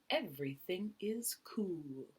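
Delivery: hushed whisper. Acted. Reader voicing Pete to the kids, whispered. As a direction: breathe it out, soft and cool. Pete's calm song line.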